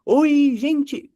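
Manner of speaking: very high bright-voiced greeting; famous greeting. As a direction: call out a celebrity's hello. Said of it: "De quem vocês lembram?". (saudação de celebridade, bem aguda)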